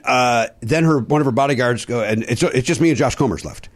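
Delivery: usual speaking voice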